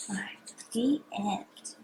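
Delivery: soft bedtime whisper. Real, not acted. Soft whisper to sleepy child.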